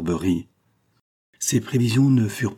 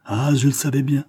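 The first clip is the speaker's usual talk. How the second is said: eager interruption; 6 semitones higher, 3 dB louder than usual. A narrator's reading. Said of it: "interrompit-il".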